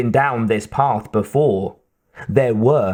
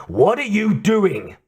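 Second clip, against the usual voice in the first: raised-pitch angry demand; 8 semitones higher than usual. A narrator's reading. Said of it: "he demanded".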